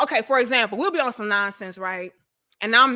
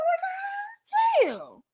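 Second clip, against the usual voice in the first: drawn-out amazement; 5 dB quieter, much slower than usual. Retold or imitated. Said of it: "I said".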